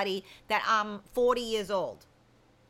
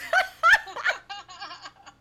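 loud laugh; 8 semitones higher, 7 dB louder than usual. Real, not acted. Laugh after reading the text.

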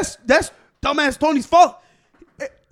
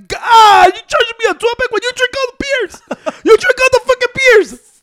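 high, loud exasperated complaint; indignant rant; 11 semitones higher, 7 dB louder than usual. Real, not acted. Complaining to friends about the beer charge, loud. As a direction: let the outrage rise in pitch.

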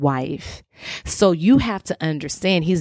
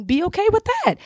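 high pleading. Acted. Urging wives to relax.

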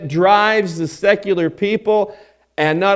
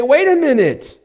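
presumptuous protest; 6 semitones higher, 4 dB louder than usual. Retold or imitated. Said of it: "Then they will say".

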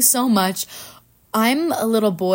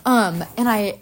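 ordinary delivery

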